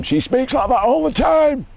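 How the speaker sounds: slow, high-pitched silly voice; funny voice. Shown as a demonstration. Dad mimicking his daughter's habit, exaggerated funny voice. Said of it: "My daughter does it all the time".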